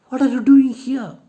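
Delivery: high, loud startled question; shocked surprise. Acted. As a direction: blurt it out in shock. John shocked to see Nora.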